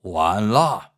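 low, loud, drawn-out bellow; thunderous roar. A narrator's reading. Enraged emperor refusing his son's plea, roaring low. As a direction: cut off the plea with a deep roar. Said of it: "雍正咆哮如雷".

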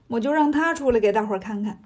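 ordinary delivery

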